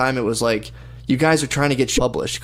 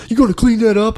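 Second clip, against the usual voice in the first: high-pitched mock complaint; scolding passer-by; 10 semitones higher, 6 dB louder than usual. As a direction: imitate a nagging passer-by, pitched way up. Passer-by scolds him over dog.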